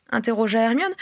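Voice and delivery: ordinary delivery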